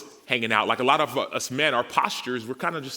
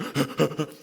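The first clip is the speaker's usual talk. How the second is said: gasp; 6 semitones higher than usual. Retold or imitated. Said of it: "I'm like".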